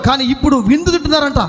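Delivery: normal talking voice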